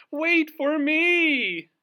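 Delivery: very high, slow, loud call; cartoon bird calling out; cry out. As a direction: call after friends left behind. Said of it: "cried Loony Little".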